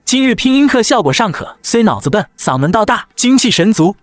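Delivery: 抬高声调的师长口吻; 居高临下地评价学生; 师尊点评. Acted. 师尊点评拼音课. (高调的师尊训话)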